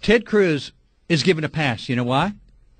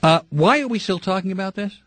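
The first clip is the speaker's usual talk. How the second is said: ordinary talk, nothing marked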